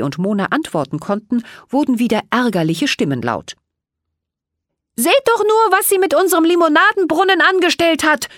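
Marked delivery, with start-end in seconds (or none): 5.0-8.3 much higher and louder, fast indignant complaint; scolding elf boy. A narrator's reading. An elf boy scolds the fairy about the lemonade fountain. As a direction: rant about the ruined fountain. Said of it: "wetterte ein Elfenjunge".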